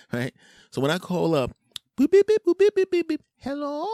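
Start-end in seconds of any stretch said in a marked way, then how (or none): 2.0-3.2 high, loud imitated dial tones; phone dial beeps. Caller dialing the restaurant, squeaky beeps. 3.4-3.9 high falsetto greeting; restaurant phone answerer. Acted. Restaurant picking up the phone, falsetto.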